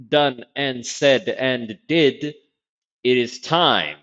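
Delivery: drawn-out, grand announcer delivery; slow, theatrical build-up to the title; hammed-up show intro. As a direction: stretch out the big game announcement.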